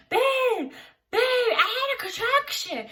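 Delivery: high-pitched, urgent plea; calling out to him in a rising panic; panicked call. Retold or imitated. Calling her husband about the contraction, high and panicked.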